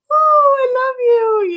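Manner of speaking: high, sweet cooing tiger voice; tamed tiger. Retold or imitated. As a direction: coo it like a lovesick tamed tiger. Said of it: "He's just like".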